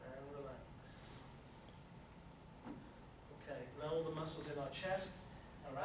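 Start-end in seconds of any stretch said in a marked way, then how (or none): 0.0-1.2 soothing relax. Real, not acted. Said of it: "guiding muscle relaxation".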